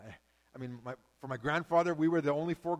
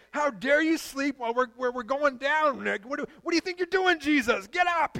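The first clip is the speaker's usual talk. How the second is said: high, loud indignant cry in another voice; angry rebuke; 13 semitones higher, 11 dB louder than usual. Acted. Disciples to sleeping Jesus in the storm, indignant high cry. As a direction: scold him awake over the storm.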